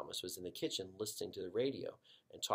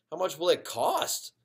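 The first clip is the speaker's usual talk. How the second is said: worried mom; 7 semitones higher, 8 dB louder than usual. Acted. Mother asks worried.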